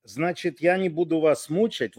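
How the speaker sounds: usual speaking voice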